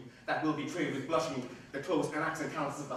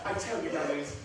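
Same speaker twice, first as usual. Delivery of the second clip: ordinary talk, nothing marked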